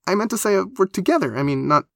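high, stumbling explanation; embarrassed and high-pitched; flustered. Acted. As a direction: backpedal awkwardly in a high voice. Flustered attempt to explain herself.

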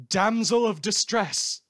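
eager announcement. Acted. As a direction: announce it like breaking news. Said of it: "very eager to say".

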